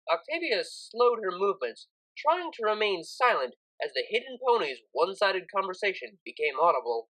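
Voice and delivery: ordinary delivery, nothing marked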